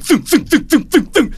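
rapid high thunking sounds; imitated arrow volley. Six arrows hit the player, imitated rapid thunking. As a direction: mimic arrows hitting one after another. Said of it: "six arrows just".

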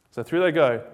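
ordinary talk

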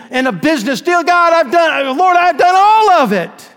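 high, loud, broken confession to the Lord; crying confession. Acted. Guilty man confesses everything before the judge, crying out high and loud. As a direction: pour it all out through tears. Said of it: "he begins to cry".

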